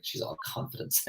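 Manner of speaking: whispered, scornful "She's all confidence"; retelling how the ladies judged her, in a whisper; disapproving whisper. Retold or imitated. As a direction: breathe it out like gossip behind a hand. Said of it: "that's the way they said it".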